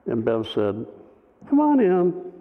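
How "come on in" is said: high-pitched imitation of a wife; wife's invitation. Wife invites the visitor in, imitated high call.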